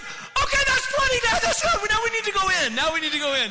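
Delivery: put-on high, loud little-girl pleading; imitated panicked child. Frightened daughter begging dad to head back in, imitated panic. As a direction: squeal it urgently, like a frightened child. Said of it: "And she's like".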